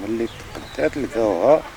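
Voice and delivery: usual speaking voice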